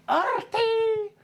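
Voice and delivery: very high put-on creature call; comic high-pitched monster calling a name; toothy dragon cry. Dragon lunges at Artie with big teeth, high cry. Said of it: "goes with his big teeth".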